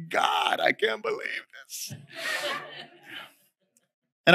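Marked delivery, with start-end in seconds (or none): 0.1-1.9 high, whispery tearful squeak; mock crying. Dad retelling his tears after praying for his son, mock crying. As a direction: imitate yourself choked up, voice thinning high. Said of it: "I'm crying because I'm like".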